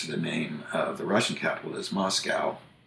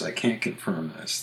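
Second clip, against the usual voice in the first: normal talking voice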